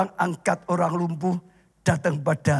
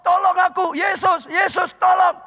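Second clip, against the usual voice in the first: high, loud desperate plea; crying out for help; 12 semitones higher, 3 dB louder than usual. Acted. Blind Bartimaeus crying out to Jesus, high and loud. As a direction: call out to Jesus over the crowd. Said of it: "dia teriak-teriak".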